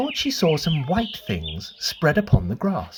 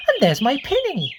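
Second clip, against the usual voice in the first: cried out; 11 semitones higher than usual. A narrator's reading. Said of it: "cried Lucy".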